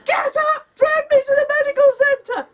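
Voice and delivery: high, loud panicked order; frantic shout. Retold or imitated. Wife demanding husband drag her to clinic, panicked shout. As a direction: yell at him to move.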